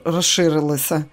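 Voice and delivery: plain reading voice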